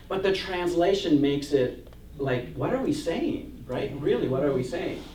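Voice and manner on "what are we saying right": usual speaking voice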